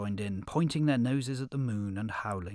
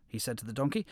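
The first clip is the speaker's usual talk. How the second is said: usual speaking voice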